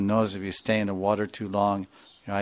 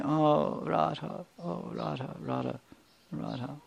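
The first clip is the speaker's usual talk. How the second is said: faint broken calls, much softer and fading; hushed weeping for his beloved; soft sobbing; 6 semitones higher, 9 dB quieter than usual. Acted. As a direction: murmur her name through tears, barely audible.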